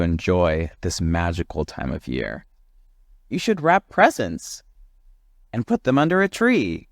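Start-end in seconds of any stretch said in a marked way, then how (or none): 3.3-6.9 higher, eager advice-giving voices; chattering friends. Acted. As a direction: pile on the helpful suggestions. Friends advising Jim.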